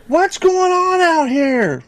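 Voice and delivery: put-on high, slow suspicious old lady voice; annoyed old lady. Neighbor lady confronting strangers in her yard, high and slow. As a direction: drag it out like a nosy neighbor.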